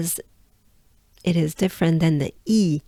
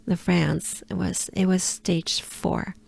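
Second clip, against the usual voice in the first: ordinary delivery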